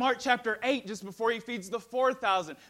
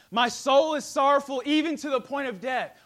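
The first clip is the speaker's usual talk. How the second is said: much louder, higher; fervent repeat; 5 semitones higher, 7 dB louder than usual. Acted. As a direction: cry it out with force. Repeated with force.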